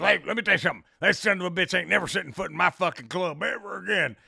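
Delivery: fast, loud owner rant; hammed-up rapid angry tirade; irate club owner. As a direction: imitate a worked-up club owner.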